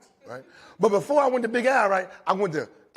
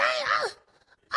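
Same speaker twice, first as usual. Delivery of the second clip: much higher, slow stammer; indignant squeal; 4 dB quieter, much slower than usual. Retold or imitated. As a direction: squeak out the protest.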